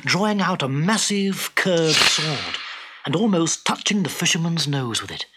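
plain reading voice

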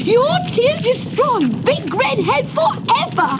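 high-pitched crowing, laugh, then urgency; gleeful high cry turning urgent; excited triumph. Acted. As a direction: exult in victory, then hurry someone along. Koshka exults, then urges escape.

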